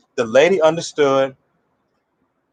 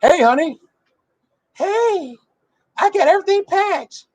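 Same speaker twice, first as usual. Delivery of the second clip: very high squeaky woman's voice; overconfident woman. Retold or imitated. Woman greeting her fiancé, packed to move, high overconfident falsetto. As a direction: imitate her in an excited falsetto. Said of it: "She's overconfident".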